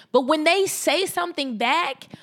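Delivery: ordinary talk, nothing marked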